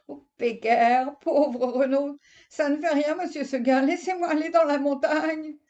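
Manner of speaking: high, pleading goat voice; pleading little goat. Acted. Goat begs Monsieur Seguin to let her go, high and pleading. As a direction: beg like a lonely young goat.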